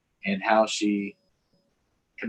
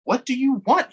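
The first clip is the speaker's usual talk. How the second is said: higher, louder irritated snap; snapped; 6 dB louder than usual. Acted. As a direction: snap at the sister. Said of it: "he snapped".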